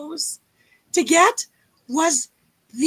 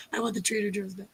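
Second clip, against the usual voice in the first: usual speaking voice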